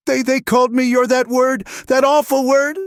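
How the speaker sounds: high, tearful, desperate voice; a woman breaking down over a cruel word; distraught young woman. Acted. Alina tells Eli what the men called her, high and distraught.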